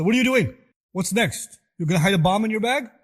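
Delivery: put-on loud, high accusing yell at his child; angry father. Father accusing his praying son, angry yell. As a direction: snap at the child like a suspicious father.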